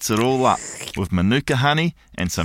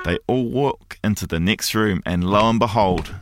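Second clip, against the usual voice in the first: ordinary delivery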